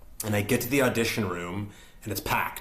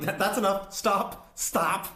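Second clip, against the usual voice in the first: high-pitched, urgent cutting-off; retold plea to halt, pitched up; imitated interruption; 10 semitones higher, slower than usual. Auditioners cutting him off.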